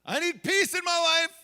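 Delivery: high, loud outburst; frustrated dad's yell. Retold or imitated. Father to daughter crying in back seat, frustrated shout. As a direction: shout it like a parent who has had enough.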